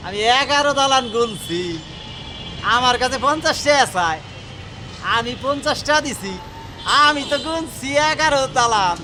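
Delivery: jackpot winner. Acted. Villager gloating he outsmarted swindler.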